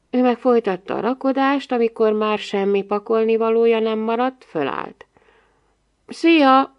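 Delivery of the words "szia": higher, louder brittle goodbye; glassy voice. A narrator's reading. Big girl taking leave of the boy, glassy voice. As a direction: toss it off in a thin glassy tone. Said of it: "mondta azon az üveghangon".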